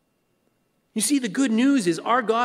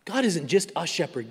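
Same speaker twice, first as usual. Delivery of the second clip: ordinary talk, nothing marked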